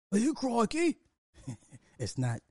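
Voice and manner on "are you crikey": much higher, hammed-up British-Aussie "crikey"; mock British accent. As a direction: send up a Brit exclaiming.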